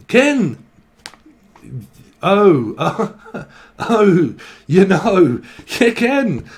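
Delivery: high, loud Scottish back-and-forth; exaggerated Scottish misunderstanding; hammed-up Scot insisting; Scottish accent. Acted Scottish misunderstanding over ken.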